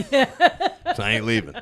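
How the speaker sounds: high, slow, laughing refusal; laughing defiance. Retold or imitated. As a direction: dig in, cracking up. Retold standoff with clerk.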